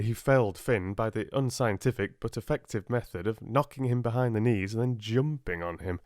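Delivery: ordinary delivery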